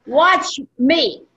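rally them to watch; calling out. Retold or imitated. Speaker challenges the home group to watch her. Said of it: "I said".

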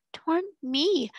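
weeping. Acted. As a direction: deny it through a hint of tears. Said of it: "wept Becky".

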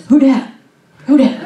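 startled, high and loud Who dat; hammed-up bewildered man blinking at the light; dazed Saul. Struck-down Saul asking the voice, mock startled. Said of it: "And he kinda goes".

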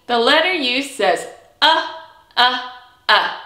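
"uh" as short open vowel; letter sound uh. Shown as a demonstration. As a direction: say "uh" clearly for the kids. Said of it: "Can you say it with me?".

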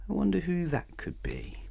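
high, soft teasing wonder; mysterious aside. A narrator's reading. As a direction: tease the listener softly. Narrator teases listener.

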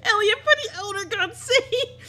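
loud, very high laughing shriek; high laughing screech; hysterical laughing. Real, not acted. Hysterical at the confession.

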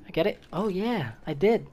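usual speaking voice

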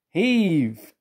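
high, drawn-out heave; called command. A narrator's reading. Fat Controller orders the engines to pull, calling out. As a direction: stretch the order out as a rallying call. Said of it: "called the Fat Controller".

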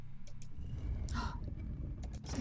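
long, breathy gasp; hushed gasp of wonder; surprised gasp. Real, not acted. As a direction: suck in air at a discovery. Discovering something hidden.

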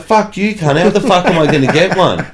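retold outrage, half laughing; laughing mock yell. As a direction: yell it at a mate while cracking up. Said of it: "I'm like".

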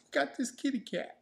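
put-on high, baby-talk kitty voice; cutesy pet-video voice. Husband imitates TikTok pet video, cutesy high voice. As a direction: coo it like a cat video caption.

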